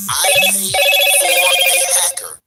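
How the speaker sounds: emphatic loud. Real, not acted. Ranting about paying a hacker.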